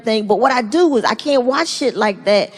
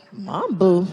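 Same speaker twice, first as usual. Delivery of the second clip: onlookers disapproving; 6 semitones lower, 4 dB quieter than usual. Retold or imitated. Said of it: "they're like".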